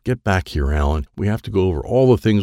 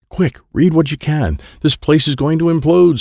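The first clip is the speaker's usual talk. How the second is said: high, louder warning call; urgent yell; 7 semitones higher, 4 dB louder than usual. A narrator's reading. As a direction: yell to hurry them out of danger. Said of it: "Sensei Dennis yelled".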